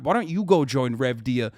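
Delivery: usual speaking voice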